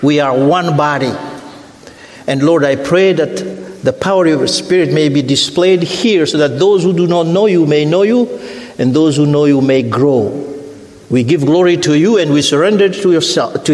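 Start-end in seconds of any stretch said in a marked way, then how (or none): none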